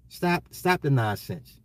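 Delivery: ordinary talk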